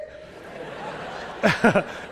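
silent, breathless laugh; breathy laugh. Real, not acted. Pastor laughing at the driver's story for the church. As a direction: laugh it out without voice.

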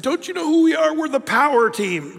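high, loud, gleeful boast; excited boast. Retold or imitated. Laughing strongmen reveal to Peter they are the Power Team. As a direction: announce it with big proud glee.

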